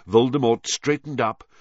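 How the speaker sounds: ordinary delivery, nothing marked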